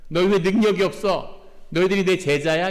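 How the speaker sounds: a mock-annoyed Jesus waking up and scolding; irritated Jesus. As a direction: scold the disciples as if fed up. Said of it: "이런 뜻이었을까요?".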